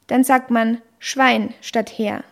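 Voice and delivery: usual speaking voice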